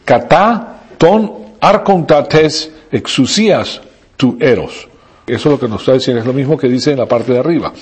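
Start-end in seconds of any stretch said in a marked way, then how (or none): 0.0-4.9 slow, careful Greek reading of "katá ton arkontates exousias tu eros"; "katá ton arkontates exousias tu eros" read slowly as a Greek phrase. Shown as a demonstration. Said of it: "las palabras que tienen ahí subrayada".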